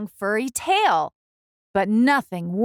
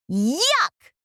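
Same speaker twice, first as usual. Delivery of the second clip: disgusted yuck; 6 semitones higher than usual. Acted. Camilla disgusted.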